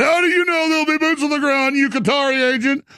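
high, strained mimicry of an indignant accuser; mock accusation. Mimics people accusing the guest.

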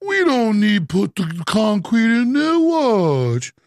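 slow, drawn-out mocking impersonation; stretched-out dismissive mimicry; mock executive. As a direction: mimic a foolish executive, dragging the words.

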